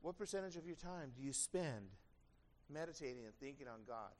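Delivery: ordinary talk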